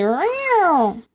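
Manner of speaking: high, drawn-out playful meow; cat yowl. Pretend. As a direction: stretch it into a catty yowl.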